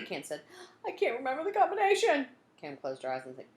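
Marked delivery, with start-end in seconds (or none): 0.9-2.3 panicked. Acted. Panicked fumbling with the lock.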